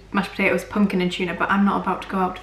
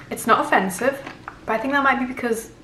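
chewing while talking; words muffled by food; mouth full; 3 semitones higher than usual. Real, not acted. Seen 2: Spoon enters mouth at 1.9, then chews while talking until ~5s.

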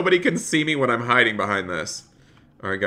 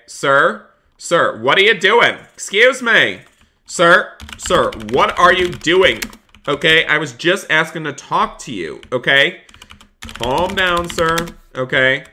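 higher, louder, pressing police voice; insistent cop; 4 semitones higher, 5 dB louder than usual. Acted. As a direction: push the driver to calm down, firmly. Cop confronting an uncooperative driver.

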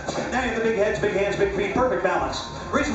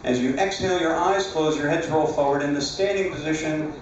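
hypnotized dreamy voice; 5 semitones lower than usual. Acted. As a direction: lull them into deep sleep. Hypnotist putting people to sleep.

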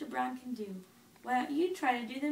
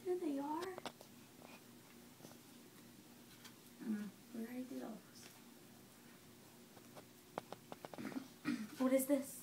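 put-on quiet, gentle questions to a baby; soft parent voice; 4 dB quieter than usual. Parent quietly quizzes the baby on body parts and objects. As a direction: ask softly, near a whisper.

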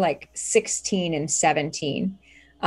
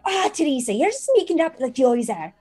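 put-on teasing teen maid; 8 semitones higher, faster than usual. GM voices maid Katie.

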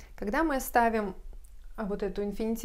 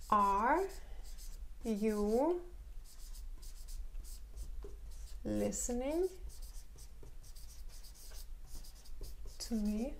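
word by word question; slow teacher demo; slow dictation; 8 dB quieter, much slower than usual. Shown as a demonstration. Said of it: "teacher models the question slowly".

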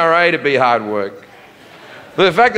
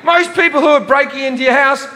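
ranting shout; 9 semitones higher than usual. Real, not acted. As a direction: yell it at the crowd, exasperated. Ranting at gun owners.

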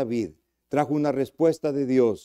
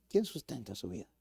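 hushed repeat; 5 semitones lower, 14 dB quieter than usual. Acted. Question repeated softly.

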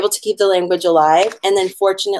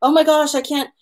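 mock overwhelmed; 8 semitones higher than usual. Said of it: "and we go".

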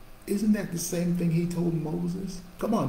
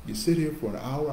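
ordinary delivery

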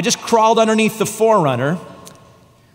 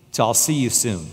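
low, soft, surrendered; at complete peace; 8 semitones lower, 5 dB quieter than usual. Retold or imitated. Pinned man telling God goodbye, low soft peace. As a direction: let go, calm as a goodbye. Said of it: "At complete peace".

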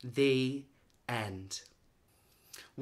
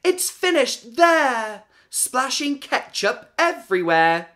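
yelling; 9 semitones higher, 10 dB louder than usual. Acted. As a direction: shout it out, proud and done. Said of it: "Samantha yelled".

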